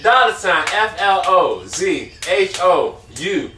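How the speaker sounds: careful slow spelling; slow spelled out. Shown as a demonstration. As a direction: spell each letter slowly. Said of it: "Hold on".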